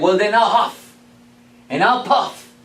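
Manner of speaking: the wolf bellowing at the brick house; wolf threat. Acted. Wolf threatens third pig.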